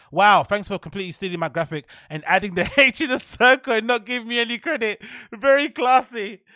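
mocking whiny quote. Reading a rival's whiny complaint.

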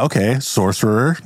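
usual speaking voice

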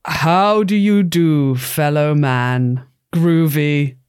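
slow, low, deliberate hippie greeting; put-on hippie voice. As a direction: talk like a groovy hippie, slowly. Player voicing disguised bear.

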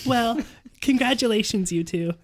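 high, loud congratulations; gleeful high-voiced daughter; delighted exclamation. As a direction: burst out happily for them. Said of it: "Calendar exclaimed".

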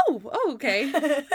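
high startled oh, then recovering; mock surprise. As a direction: act caught off guard. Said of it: "And surprises her".

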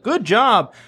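high, bright mock pat on the head; hammed-up praise from a teacher. Teacher praising a first grader for a book, mock praise.

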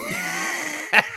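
very high shriek of laughter; squealing laugh. Real, not acted. Remembering the basketball mishap, shrieking laugh.

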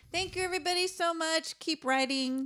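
high, bright goodbye; cheery sign-off. Real, not acted. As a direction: sing out the thanks.

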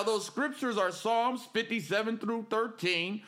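ordinary talk

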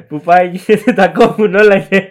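loud, cracking up mid-sentence; words pushed out through laughter; real laughter breaking into the retelling; laughing while talking. Real, not acted.

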